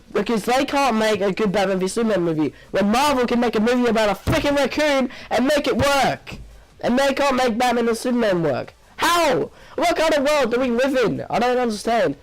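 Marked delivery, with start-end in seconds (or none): 2.7-12.1 raised pitch, incredulous outburst; exasperated rant. Real, not acted. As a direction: rant in utter disbelief at the studio. Exasperated rant about the studio.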